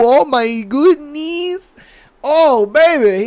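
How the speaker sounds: high, slow, drawn-out amazement; exaggerated wowed delight, stretched out; mock lustful awe. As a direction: play a hammed-up ogler savoring it. Acts colleague ogling the photo.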